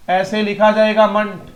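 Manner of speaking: ordinary talk, nothing marked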